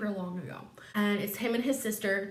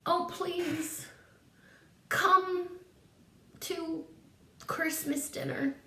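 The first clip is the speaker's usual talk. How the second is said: high, soft, halting plea; hammed-up pleading nephew, pitched high; mock pleading; 7 semitones higher, 3 dB quieter, much slower than usual. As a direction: beg sweetly, word by word.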